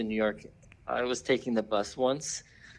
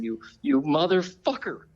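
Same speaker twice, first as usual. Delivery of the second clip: higher-pitched, stammered curse, not shouted; hammed-up indignation in a raised voice; mock angry woman; 6 semitones higher than usual. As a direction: imitate an outraged woman sputtering. Retold woman hitting man with bag.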